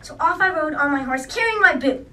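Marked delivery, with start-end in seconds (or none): 1.2-2.1 laughing through the words. Acted. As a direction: giggle as you say it. Laughing at own joke.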